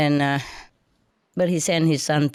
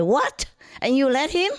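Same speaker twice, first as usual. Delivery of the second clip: higher, indignant mock demand; retold angry disbelief; 9 semitones higher than usual. Telling off the taxi driver, mock indignant. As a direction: replay the outrage, pitched up. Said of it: "I was so mad".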